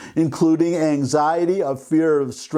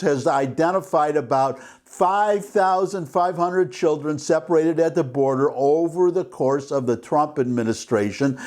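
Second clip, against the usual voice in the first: ordinary talk, nothing marked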